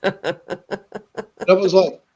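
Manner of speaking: slow, laughing through the words. Real, not acted. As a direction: chuckle it out as you remember. Laughing at mom's dog bowl.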